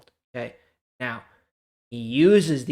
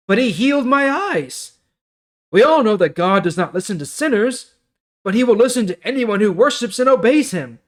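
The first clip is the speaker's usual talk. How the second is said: higher and louder, an earnest plea; healed man defending Jesus; 7 semitones higher, 7 dB louder than usual. Acted. Healed man answers the Pharisees, high, loud and earnest. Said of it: "The man answered".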